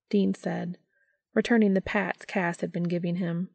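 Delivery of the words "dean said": ordinary delivery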